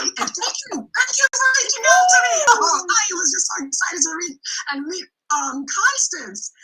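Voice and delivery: high, loud, gushing thank-you; overjoyed thanks. Real, not acted. Guest thanks the host for reaching out, high and loud with joy. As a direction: burst out with joy.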